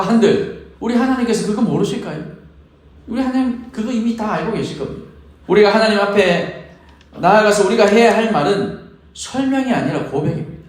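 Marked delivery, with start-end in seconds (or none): none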